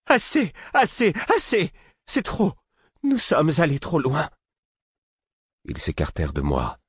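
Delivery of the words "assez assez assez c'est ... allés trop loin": much higher shrieking cry of enough; high, woman-like voice. Acted. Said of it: "d'une voix aiguë, comme celle d'une femme". (voix aiguë, comme celle d'une femme)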